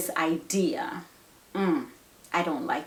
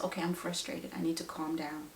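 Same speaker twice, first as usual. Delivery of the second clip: much softer, low-key self-talk; self-calming; 3 semitones lower, 11 dB quieter, faster than usual. Acted. Parent noticing own frustration, quietly self-calming. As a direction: talk yourself down under your breath. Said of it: "you can intentionally recognize".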